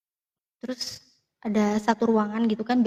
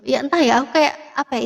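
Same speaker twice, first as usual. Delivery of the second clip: ordinary delivery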